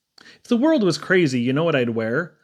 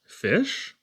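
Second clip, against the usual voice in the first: puzzled question; 6 semitones higher, 4 dB quieter than usual. A narrator's reading. Title said as odd question.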